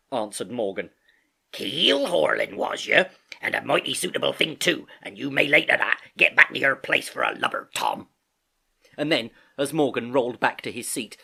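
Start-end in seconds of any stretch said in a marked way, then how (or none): 1.5-8.1 loud, scornful sailor voice; Long John Silver. Acted. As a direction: dismiss him with rough authority. Silver scolds Morgan, dismisses him.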